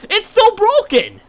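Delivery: high, loud, slow disbelieving cry; exasperated yell. Real, not acted. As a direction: shout it in fed-up disbelief.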